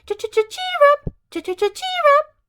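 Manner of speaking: high chirpy birdsong voice; bird call. Acted. The little brown bird singing to the Emperor, high chirp.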